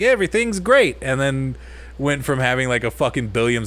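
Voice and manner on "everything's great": high-pitched mock reassurance; mock company cheer. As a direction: parody a company insisting all is well. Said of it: "were just like".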